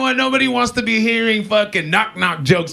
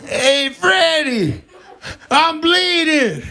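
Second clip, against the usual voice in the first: mobster wailing; 9 semitones higher, much slower than usual. Pretend. Mob guy wails to Freddie, very slow and high. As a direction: stretch it out like a hammed-up dying mobster. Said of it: "he goes up and he's like".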